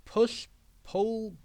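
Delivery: usual speaking voice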